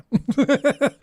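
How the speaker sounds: bubbling, high-pitched giggle; short burst of high laughter. Real, not acted. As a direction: crack up at your own mockery. Laughs at the mock pity.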